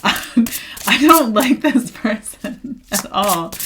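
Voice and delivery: slow, laughing disapproval; laughing slowly. Real, not acted. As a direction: laugh through the dislike. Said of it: "I'm like".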